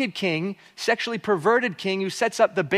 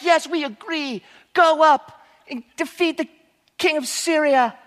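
higher, eager little yes-men; little baby yes-men; 7 semitones higher than usual. Acted. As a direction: chime in like eager little sycophants. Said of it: "all these little baby guys".

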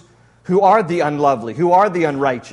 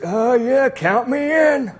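put-on higher, slow, eager old man; ninety-nine-year-old Abram; 5 semitones higher, much slower than usual. Preacher voicing old Abram agreeing to God, high slow old voice. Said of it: "Let me get the voice".

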